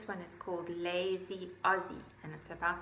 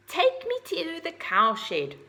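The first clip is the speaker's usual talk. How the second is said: high, loud and slow order; urgent command; 8 semitones higher, 5 dB louder, slower than usual. Acted. Owl ordering the horse to the cowshed, loud and urgent. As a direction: demand the ride. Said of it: "shouted Ozzy".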